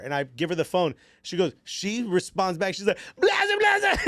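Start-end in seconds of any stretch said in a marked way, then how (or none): 3.2-4.0 high, loud Serbian chant yelled out; excited yell. Retold or imitated. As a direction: belt out the chant like a thrilled fan. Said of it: "yelling something".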